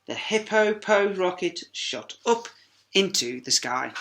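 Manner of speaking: usual speaking voice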